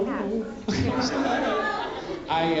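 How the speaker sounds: ordinary talk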